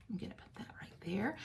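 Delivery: whispered aside to herself; a soft whispered aside while working. Real, not acted. Aside while placing sentiment.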